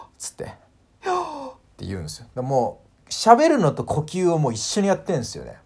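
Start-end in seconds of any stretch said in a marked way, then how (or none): 1.1-1.5 high よー sucked inward; inhaled speech. Shown as a demonstration. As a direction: Finnish agreement said on an in-breath. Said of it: "息を吸いながら".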